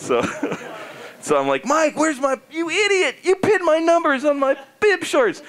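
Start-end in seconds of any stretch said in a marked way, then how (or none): 1.6-5.4 high, worked-up scolding; mock angry accusation. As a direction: lay into the manager in comic fury. Retold quarrel with team manager.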